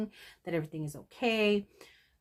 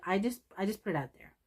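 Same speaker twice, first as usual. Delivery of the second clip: ordinary talk, nothing marked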